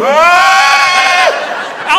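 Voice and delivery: a sudden, high, shouted scream of panic; high panicked scream. Retold or imitated. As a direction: shriek as the shark comes at you. Shark heading at him.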